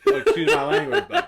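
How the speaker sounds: laughing. Real, not acted. Laughing at own swearing.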